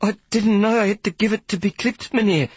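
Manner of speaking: put-on very high, frightened small boy; boy afraid of punishment from the conductor; suddenly fearful. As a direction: blurt it out in sudden fear. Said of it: "I said, suddenly fearful".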